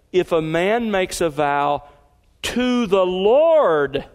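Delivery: slow down and lean hard on "to the Lord"; stress on to the Lord. Retold or imitated. Preacher mimicking the scribes' reading, stressing "to the Lord". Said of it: "Here's the scribe's emphasis".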